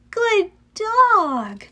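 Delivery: high, swooping praise; praising a dog. A narrator's reading. Annabeth praises Cerberus once they pass, approvingly. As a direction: praise the dog, letting each word fall.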